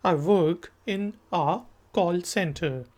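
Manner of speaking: slow, clear sentence for learners to repeat; slow model line. Shown as a demonstration. Teacher voices the girl's reply slowly for the class. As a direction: lay each word down slowly. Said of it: "लड़की कहती है कि".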